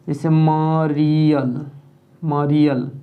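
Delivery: मरियल said slowly, syllable by syllable; demo word मरियल. Shown as a demonstration. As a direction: stretch out मरियल clearly for the class. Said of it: "मरियल ऐसा होता है". (मरियल को धीरे-धीरे साफ़ बोलना)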